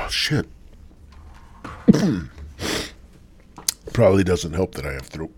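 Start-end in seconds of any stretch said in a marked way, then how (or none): 1.6-2.9 hacking smoker's cough; harsh coughing fit. Real, not acted. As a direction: cough hard after a big hit. Coughing after hit.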